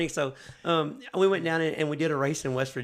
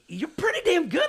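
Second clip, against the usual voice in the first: enthusiastic praise; 12 semitones higher than usual. Retold or imitated. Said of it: "I'm like".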